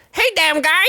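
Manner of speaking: high teasing imitation; mock friend. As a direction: tease in a squeaky high voice. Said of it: "'Cause I was like".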